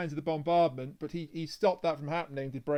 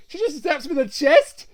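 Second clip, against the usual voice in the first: loud, high-pitched exclamation; big, high, incredulous-sounding yell; excited outburst; 8 semitones higher, 10 dB louder than usual. Real, not acted. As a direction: shout the climax with relish. Excited climax of plot retelling.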